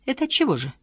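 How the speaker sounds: surprised question. A narrator's reading. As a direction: pitch jumps way up. Wife asks sharply.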